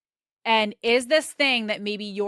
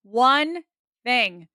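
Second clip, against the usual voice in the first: "One", pause, "thing", louder on One; very slow, simple; 3 semitones higher, 6 dB louder than usual. Shown as a demonstration. Coach drumming one priority into students, very slow and simple. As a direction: break "One thing" into two slow, separate words. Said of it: "very slow and very simple".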